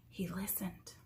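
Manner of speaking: hushed, breathless whisper. A narrator's reading. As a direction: whisper it as if listening hard. Tense pause, listening.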